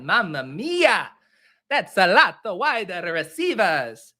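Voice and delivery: slow, stretched cartoon Italian accent; over-the-top drawn-out Italian exclamation; caffeinated Mario. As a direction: ham it up in a bad Italian accent. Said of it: "it's Mario strung out on caffeine".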